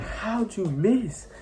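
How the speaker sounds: very high, drawn-out disbelief; incredulous. Retold or imitated. Faded patient to the medic who missed the vein, incredulous. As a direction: stretch the question out in high-pitched amazement.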